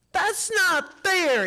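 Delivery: put-on slow, high, furious boy; a small boy shouting it out; angry child.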